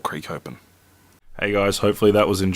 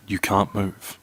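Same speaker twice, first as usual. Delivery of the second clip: ordinary delivery, nothing marked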